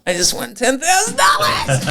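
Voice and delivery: high, squealing, laughing reveal; ecstatic announcement. Retold or imitated. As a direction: burst out laughing with the big win. Acts out telling wife he won.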